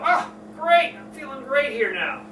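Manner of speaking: mock delight.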